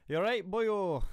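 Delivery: loud, high attempt at Welsh; hammed-up Welsh lilt, badly faked; bad Welsh accent. Shown as a demonstration. Streamer showing all the Welsh he has, in a bad Welsh accent. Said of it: "I can't do a Welsh accent".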